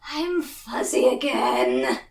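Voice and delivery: ordinary talk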